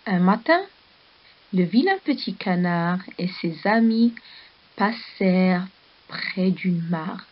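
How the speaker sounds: put-on calm storybook reading for children; story narration. A narrator's reading. Teacher reading a story to the class, storybook voice. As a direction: read the tale gently to the pupils.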